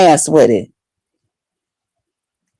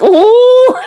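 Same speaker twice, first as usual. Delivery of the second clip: high mock whimpering ooh; hammed-up crying ooh; scared whimper; 13 semitones higher than usual. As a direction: whimper like a frightened kid.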